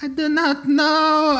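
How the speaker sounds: high, slow, wavering robot voice; shaky robot. Acted. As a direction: play a frightened robot, tone unsteady. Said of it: "you're getting shaky in your tonality".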